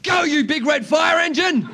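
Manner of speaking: high, slow, full-throated yell; acted drawn-out shout. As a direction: bellow it proudly to the audience. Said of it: "yell your name".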